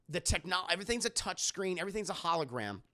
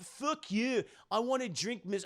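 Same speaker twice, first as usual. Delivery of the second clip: put-on higher, mean Scottish accent; mock Scottish accent; 5 semitones higher than usual. Rude geologist rebuffing the biologist, mock Scottish accent. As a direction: put on a surly Scottish brogue. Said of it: "he's very Scottish".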